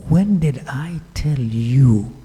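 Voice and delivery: slow, deliberate challenge; slow pointed question. Retold or imitated. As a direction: drag each word to make the point.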